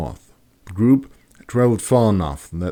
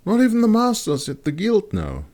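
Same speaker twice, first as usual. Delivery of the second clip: astrologer woman voice. Acted. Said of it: "she replied".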